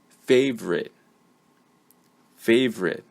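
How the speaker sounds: "favorite" said alone as FAV-rit, reduced ending; careful word model. Shown as a demonstration. Teacher saying the word so learners find the schwa, careful model. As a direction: dictate "favorite" clearly, then repeat it. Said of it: "I'm going to say the word".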